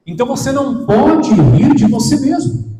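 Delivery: loud, driving exhortation; emphatic preaching. Real, not acted. Preacher to congregation, loud and emphatic. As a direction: hammer the point home to the church.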